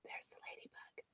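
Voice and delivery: put-on hushed whisper to the children. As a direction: whisper it softly.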